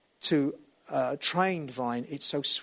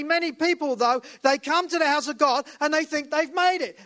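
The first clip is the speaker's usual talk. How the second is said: higher, louder preaching, building; full-force preaching; 7 semitones higher, 3 dB louder than usual. Real, not acted. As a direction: preach it out to the congregation.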